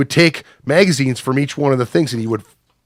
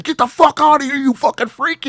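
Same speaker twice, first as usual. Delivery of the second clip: very high-pitched retold yell; acted screechy chasing-off; outraged eviction; 13 semitones higher than usual. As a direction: drive him out in a high frantic voice. Retelling chasing man with a pipe.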